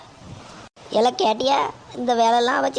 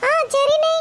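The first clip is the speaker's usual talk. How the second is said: put-on much higher, brighter young woman's voice; daughter-in-law voice; 4 dB louder, slower than usual. Daughter-in-law answering her mother-in-law, in a much higher young voice. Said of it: "மருமகளே, போய் coffee கொண்டு வாமா".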